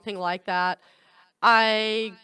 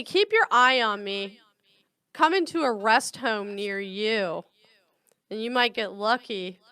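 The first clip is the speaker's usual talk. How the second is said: normal talking voice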